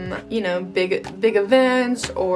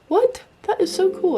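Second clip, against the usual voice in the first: surprised; 12 semitones higher than usual. Real, not acted. Surprised by forgotten page.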